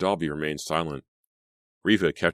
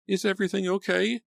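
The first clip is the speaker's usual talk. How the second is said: much higher, timid anxious voice; anxious murmur. A narrator's reading. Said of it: "Grace murmured anxiously".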